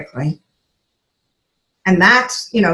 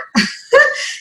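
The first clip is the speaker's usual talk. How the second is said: a quick high airy laugh. Real, not acted. Recalling her sick friend's refusal, quick high laugh. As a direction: laugh at the memory.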